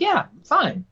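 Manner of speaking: a light retold high-pitched reassurance; reassuring dad.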